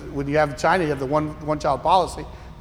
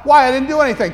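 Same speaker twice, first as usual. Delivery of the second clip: put-on higher, protesting child; whiny kid; 7 semitones higher, 5 dB louder, faster than usual. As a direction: complain like a wronged kid. Voicing a complaining kid.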